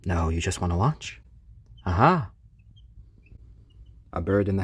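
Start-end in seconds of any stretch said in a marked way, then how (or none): 1.9-2.3 loud burst of triumph; 1 triumphant aha. Real, not acted. Owner cheering as chick hops on, triumphant.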